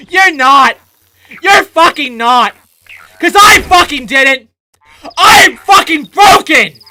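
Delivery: 3 scream out your frustration at the game; 4 breaking down into shouting, fed up; 1 furious shout. Real, not acted.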